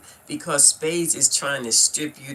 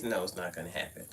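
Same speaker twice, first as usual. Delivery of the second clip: usual speaking voice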